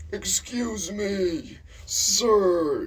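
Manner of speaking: high, drawn-out imitation of an old teacher yelling; mock an irate old man, loud and high; pissed-off old man. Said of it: "he would just get pissed off".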